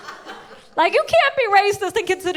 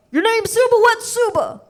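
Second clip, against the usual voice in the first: mocking caller voice; 8 semitones higher than usual. Customer quoted mockingly.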